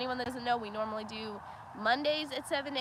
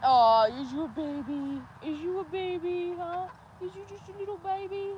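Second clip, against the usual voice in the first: sweet pet-talk voice, pitched up; 7 semitones higher, 5 dB louder than usual. Real, not acted. Cooing at the calf.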